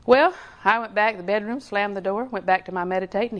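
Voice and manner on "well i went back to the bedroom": ordinary delivery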